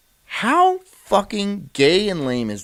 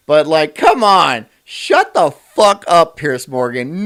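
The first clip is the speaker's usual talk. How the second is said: exasperated rant; 7 dB louder, slower than usual. Real, not acted. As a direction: tell him off at full volume. Ranting at Piers Morgan on stream.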